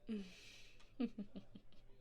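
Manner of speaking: low-key, hushed knowing chuckle; soft chuckle. Acted. Hostess amused by her own strict rules, soft chuckle. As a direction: chuckle quietly to yourself.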